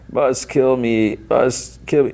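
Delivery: high, slow, droning mock groan; distorted voice. Severed head insists to Lucy, slow distorted voice. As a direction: drag it out like a distorted, dying head. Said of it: "in a distorted voice".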